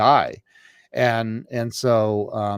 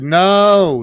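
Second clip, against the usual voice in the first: retold loud refusal; 8 semitones higher, 11 dB louder than usual. Refusing sister's offer, retold.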